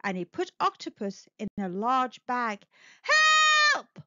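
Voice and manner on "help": high, loud frightened wail. Acted. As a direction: cry out from inside the bag. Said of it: "wailed Octopus".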